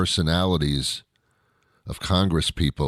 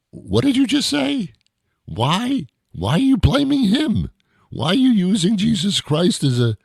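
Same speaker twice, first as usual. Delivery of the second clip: much higher, incredulous questioning; incredulous squeal; 12 semitones higher than usual. Real, not acted. Man indignant at people cursing, squeaking his questions high.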